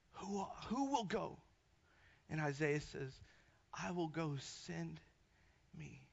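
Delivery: low, soft, reverent scripture delivery; hushed sermon. Acted. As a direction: drop low and quiet, with long pauses.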